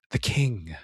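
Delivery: low, soft, drawn-out announcement of the title; hushed trailer-voice reveal; dramatic title reveal. Acted. Title announced after "without further ado.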